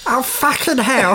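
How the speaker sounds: high, slow put-on cursing; imagined neighbour. Podcaster imitating the tile cutter next door, high mock exasperation. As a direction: drag out a comic high-voiced swear. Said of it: "That's how I imagine him to sound".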